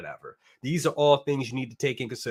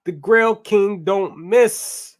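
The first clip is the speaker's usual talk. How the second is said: louder, higher, drawn-out hype line; hyped read; 6 semitones higher, 8 dB louder, much slower than usual. Acted. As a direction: proclaim a fan's boast slowly and loudly. Reading fan's hype comment aloud.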